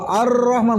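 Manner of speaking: "Ar-Rahman" with a quick long trill; overdone roll on the R of AR-RAHMAN; too many Rs. Shown as a demonstration. Teacher explaining the mistake, R over-rolled. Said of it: "berarti rohnya ada banyak banget".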